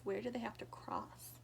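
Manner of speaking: ordinary delivery, nothing marked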